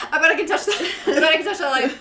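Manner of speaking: giggling through the words; laughing. Real, not acted. Giggling to her friend about touching the light. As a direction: laugh while daring herself to reach it.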